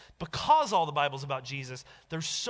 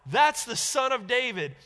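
loud, raised cry to the crowd; yelling out; 6 semitones higher, 8 dB louder than usual. Retold or imitated. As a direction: call it out to be heard over the noise. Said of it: "if he starts yelling out".

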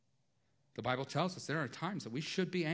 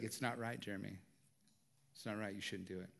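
quiet reproach; 4 semitones lower, 10 dB quieter than usual. Retold or imitated. Wife's gentle reproach retold.

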